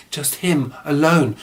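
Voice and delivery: ordinary delivery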